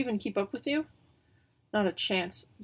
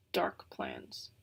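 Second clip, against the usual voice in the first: mock-scary deep murmur; scarily; 7 dB quieter than usual. Pivo teasing the birds, mock-spooky low voice. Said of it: "Pivo says scarily".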